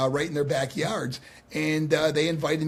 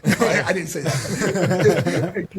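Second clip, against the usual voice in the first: laughing; 3 semitones higher, 6 dB louder, much slower than usual. Real, not acted. Laughing off his own joke.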